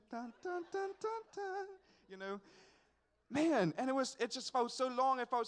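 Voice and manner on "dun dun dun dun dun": mock dramatic dun dun, climbing high; slow-motion dramatic music. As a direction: hum a playful slow-motion soundtrack. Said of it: "you know, slow motion".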